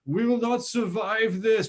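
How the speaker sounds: high, slow mock Japanese accent. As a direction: imitate a movie alien with a Japanese accent. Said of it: "speak in a Japanese accent".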